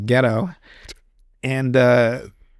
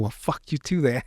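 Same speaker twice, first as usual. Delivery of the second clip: muttered grumble; 7 dB quieter than usual. Retold or imitated. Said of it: "He's like".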